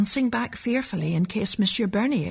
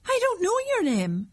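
character voice; 13 semitones higher than usual. Acted. Other character replies.

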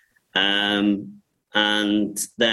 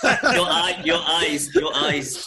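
amused retort through laughter; laughing while talking; 8 semitones higher, slower than usual. Real, not acted. Director answering the actor's anecdote, laughing. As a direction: start high and laughing, settle as it goes.

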